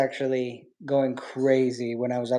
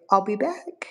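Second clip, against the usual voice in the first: coaxing line for the bird; 7 semitones higher than usual. Shown as a demonstration. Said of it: "Can you say".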